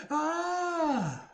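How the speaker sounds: sharp scared yelp; startled cry. Real, not acted. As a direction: jump at the scare. Startled by jumpscare.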